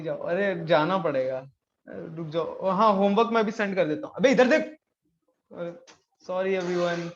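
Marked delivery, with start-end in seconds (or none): 4.2-4.8 loud, sudden rebuke to someone nearby; sharp call-out. Real, not acted. Teacher turning from class to scold a kid: loud call-out. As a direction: snap his attention back, loudly.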